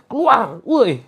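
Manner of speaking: loud, high yell at the jinn; rough yell. Shown as a demonstration. Showing a rough command while rubbing the spot, yelled. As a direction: holler at it like a street tough. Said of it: "Diurut saja".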